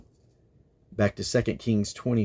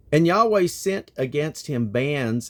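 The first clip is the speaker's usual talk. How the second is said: scripture reading; 5 semitones higher, 4 dB louder than usual. Acted. Reads scripture aloud.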